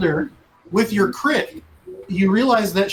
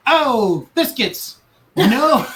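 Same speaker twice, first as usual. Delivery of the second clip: 1 captain's alarm; 5 semitones higher, much slower than usual. Acted. Captain realizes the danger.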